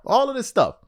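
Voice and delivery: ordinary talk, nothing marked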